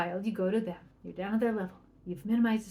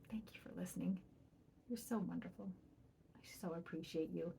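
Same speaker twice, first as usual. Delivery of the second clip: soft whispered thanks; whispered praise; 3 semitones lower, 16 dB quieter than usual. Shown as a demonstration. Parent thanks child for listening, in a whisper.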